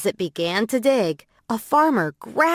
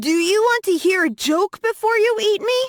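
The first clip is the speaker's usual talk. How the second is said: much higher, fast bunny voice; scared bunny; 12 semitones higher, much faster than usual. Acted. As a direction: rattle it off high and quick like a frightened bunny playing for time. Scared bunny stalls the crocodile.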